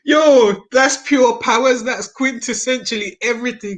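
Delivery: excited. Real, not acted. Excited reaction to host's tweet.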